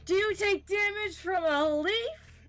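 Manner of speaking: high, loud, disbelieving question; loud incredulity. Real, not acted. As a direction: pitch it way up in disbelief. Game master mocks absurd damage question.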